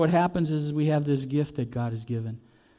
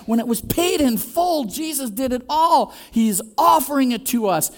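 preaching at full force; 8 semitones higher than usual. Real, not acted. Preacher proclaiming Jesus paid it all, fervent. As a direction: drive the point home to the congregation.

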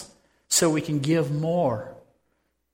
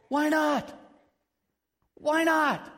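pitched way up, asking why not in disbelief; incredulous; 12 semitones higher than usual. Real, not acted. Preacher to congregation, defending the modest neighbor, high and incredulous. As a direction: throw the question up high, amazed.

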